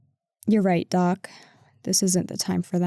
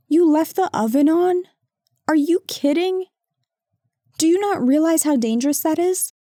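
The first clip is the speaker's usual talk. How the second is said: higher and louder, alarmed at the partner; alarmed disbelief; 7 semitones higher, 3 dB louder than usual. Acted. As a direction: raise your voice in shocked disbelief. Alarmed at oven left on.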